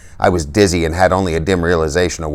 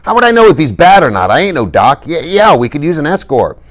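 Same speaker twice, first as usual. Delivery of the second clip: much higher, louder, mock-fed-up voice; mock-exasperated tough; 10 semitones higher, 4 dB louder than usual. A narrator's reading. Dally bluffing the policeman, exasperated. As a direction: play the annoyed tough for the cop. Dally putting on a show for cop.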